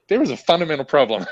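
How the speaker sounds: laughing out the punchline. Real, not acted. Man laughing as he tells her about workers voting like bosses. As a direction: chuckle through the point, amused. Laughs while making his point.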